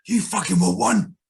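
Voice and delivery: high, loud mock aggro snap; aggressive retort. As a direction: imitate a hostile drunk lashing out. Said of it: "And he went".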